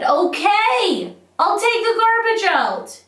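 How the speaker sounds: high, loud, theatrical surrender; exasperated giving in. Acted. Stubborn girl yielding over the garbage, exasperated mock yell. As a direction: cry out as a girl finally caving. Girl in poem finally gives in.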